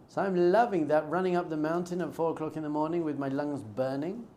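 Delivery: usual speaking voice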